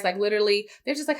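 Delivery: ordinary talk, nothing marked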